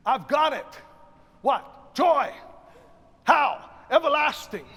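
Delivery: loud, high call and response; bought-in preaching. Acted. Choir director showing singers who've bought in, loud emphatic exchange. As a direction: fire out each word with full conviction. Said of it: "you've bought in".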